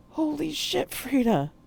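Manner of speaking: high, startled exclamation; exclaimed. Acted. Poe shocked at what Frida did, exclaiming. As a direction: blurt it out in shock. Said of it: "I exclaimed".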